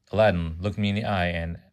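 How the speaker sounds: ordinary delivery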